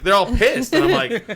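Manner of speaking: high, loud laugh through the words; cracking up while telling it; amused disbelief. Real, not acted. Laughing about the angry staff.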